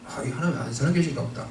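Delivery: quiet doubting mutter. Retold or imitated. Retold inner thought of doubt.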